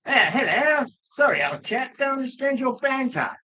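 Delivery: high, plummy mock-British toff; hammed-up posh Englishman caricature. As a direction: send up the clueless British gent. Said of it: "and the British would be".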